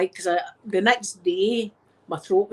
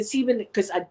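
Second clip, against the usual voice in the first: ordinary talk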